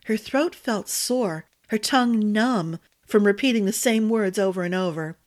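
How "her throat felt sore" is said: ordinary delivery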